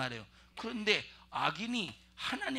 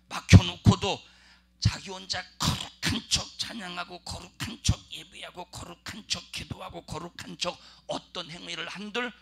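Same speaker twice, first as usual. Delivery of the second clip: fervent preaching; 3 semitones higher, 9 dB louder than usual. Real, not acted. Preacher denouncing hypocrisy.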